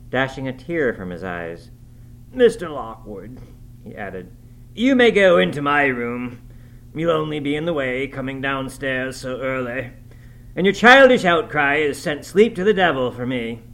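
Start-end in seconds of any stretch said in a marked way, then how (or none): 2.3-13.6 Heathcliff's raised, louder voice, scolding the guest; exasperated, forceful character voice; irritated host. A narrator's reading. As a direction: order the guest off in vexation. Upset host scolds his guest.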